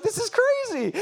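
high, laughing exclamation; retold disbelief. Telling of an altar call with zero response, high disbelief.

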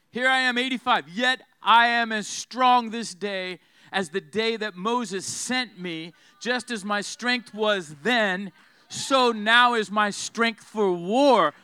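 slow, loud scripture proclamation; full-force preaching. Acted. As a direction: declare Caleb's strength, punching each phrase. Preaches Caleb's words at full force.

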